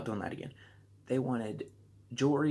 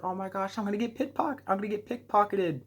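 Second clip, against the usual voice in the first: mock worry; 8 semitones higher than usual. Worried in the crowd.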